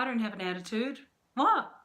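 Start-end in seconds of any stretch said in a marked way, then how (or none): 1.3-1.7 sharp high rising what, louder; mock innocent what. Wife denying her attitude to her husband, high indignant what. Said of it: "I could either sit there like".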